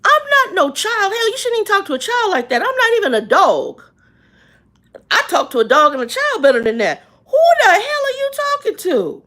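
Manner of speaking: high, loud, outraged yelling at him; furious rant. Retold or imitated. Berating the rude cashier, high loud outrage. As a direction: tear into him with indignant fury. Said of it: "I'm like".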